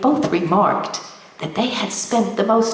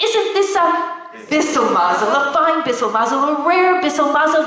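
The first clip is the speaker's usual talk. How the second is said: delighted exclaim; 6 semitones higher than usual. Acted. Old woman delighted at stone.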